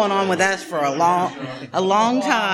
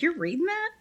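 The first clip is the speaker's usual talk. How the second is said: high, soft surprised quote of her friend; 11 semitones higher, 4 dB quieter than usual. Retold or imitated. Friend Pat on the phone, mildly surprised. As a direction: echo his mild disbelief. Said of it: "He's like".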